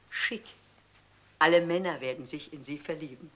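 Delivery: normal talking voice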